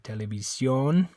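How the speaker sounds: "televisión" with E sounds and a pure O; Spanish televisión. Shown as a demonstration. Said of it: "this O also makes an O sound".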